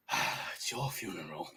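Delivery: low, soft, sighing line; resigned warning. Acted. Sir Rory before charging the bandit, low resigned warning. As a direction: let it drop wearily, unimpressed.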